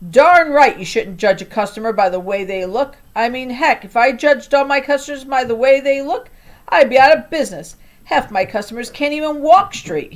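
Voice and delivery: loud folksy shopkeeper on the phone; gruff liquor store man. Acted. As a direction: bluster it like a fed-up store owner.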